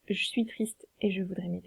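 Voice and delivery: soft, breathy wrong version; wrong reading whispered. Shown as a demonstration. Teacher whispers the incorrect scansion. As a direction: murmur the wrong way, almost voiceless. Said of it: "on peut pas dire".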